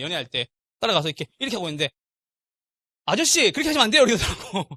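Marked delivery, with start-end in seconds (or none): 3.1-4.0 lightly mimicked wife's scolding, higher and louder; raised-pitch mock telling-off; imitating wife. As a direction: playfully correct him as his wife would. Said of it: "저한테 와이프가".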